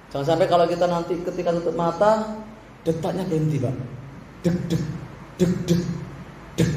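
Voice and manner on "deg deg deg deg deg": soft low thumping heartbeat sounds; heartbeat imitation. Preacher imitating a heartbeat stopping, soft and low. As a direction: tap out a dying heartbeat quietly.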